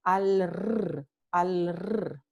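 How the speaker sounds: al, r with a strong rolled r; slow trill demo. Shown as a demonstration. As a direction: roll the r after the l. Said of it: "es la vibrante múltiple".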